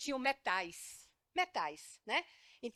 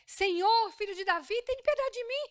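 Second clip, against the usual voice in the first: high, loud desperate plea; pleading shout of the blind beggar; blind man crying out; 9 semitones higher, 4 dB louder than usual. Acted. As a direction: cry out over the crowd for mercy. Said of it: "E ele gritava".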